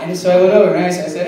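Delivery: usual speaking voice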